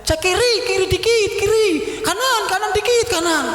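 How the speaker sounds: high-pitched comic imitation of directing; shouted directions. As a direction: call out like a football commentator. Doctor casually directs while he hurts.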